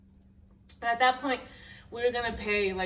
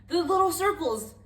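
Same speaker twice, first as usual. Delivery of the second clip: higher and louder mimicry of a grumpy boyfriend; mock boyfriend voice; 9 semitones higher, 9 dB louder than usual. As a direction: play him as curt and fed up.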